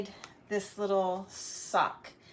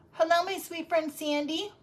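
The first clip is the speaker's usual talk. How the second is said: normal talking voice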